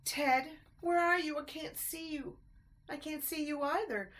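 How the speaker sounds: high anxious calls; scared calling. A narrator's reading. Lost mice calling in the dark, high and anxious. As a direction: search for each other in the dark.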